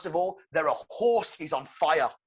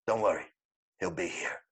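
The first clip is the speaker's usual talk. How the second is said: low reassuring Gordon; 9 semitones lower than usual. Acted. Gordon answers Bullock on the roof, low reassurance. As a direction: drop down and let it settle.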